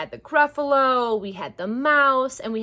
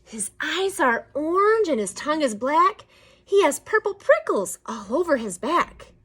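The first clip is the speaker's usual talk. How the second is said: lightly voiced mouse, a bit higher; storybook mouse; 5 semitones higher than usual. Mouse describing the Gruffalo to snake, lightly voiced storybook mouse. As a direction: describe it with lively, lilting menace.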